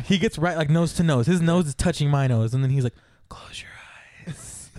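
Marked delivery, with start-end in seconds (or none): 3.3-4.7 very soft, intimate whisper; seductive whisper. Retold or imitated. Comedian about to kiss his volunteer, mock-intimate whisper. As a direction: breathe it from nose to nose.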